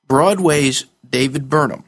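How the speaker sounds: ordinary delivery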